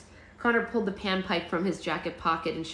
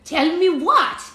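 put-on high, loud squeal of a little boy; hammed-up excited kid; wide-eyed astonishment; 11 semitones higher, 11 dB louder, much slower than usual. A narrator's reading. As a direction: burst out with eager curiosity. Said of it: "Emmerich's eyes grew and his mouth fell open".

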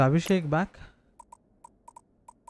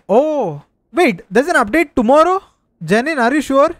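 surprised; 6 semitones higher, 4 dB louder than usual. Real, not acted. Surprised at news of update.

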